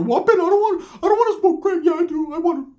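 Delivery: squeaky high voice, way up top; high mock voice of the tweaker; high-pitched Ricky. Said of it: "Ricky's over there".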